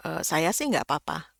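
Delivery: ordinary talk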